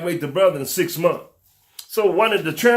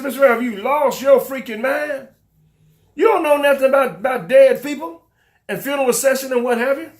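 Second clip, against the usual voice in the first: scoffing board member; 6 semitones higher than usual. Retold or imitated. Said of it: "They look at me and say".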